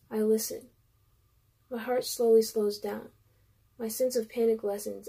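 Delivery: usual speaking voice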